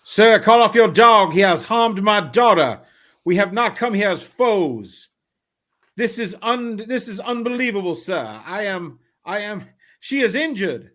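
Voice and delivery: high, loud, urgent plea; pleading gentleman. Acted. Father begs the gunman to call off the dog, loud and high.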